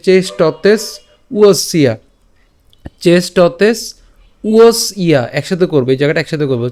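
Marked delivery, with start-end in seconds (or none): 0.1-5.2 "চেষ্টতেস" rising, a pause, then "উয়সিয়া" falling; split pronunciation. Shown as a demonstration. Teacher showing where to break, "চেষ্টতেস" pause "উয়সিয়া". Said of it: "তো এই জায়গায় খেয়াল করি".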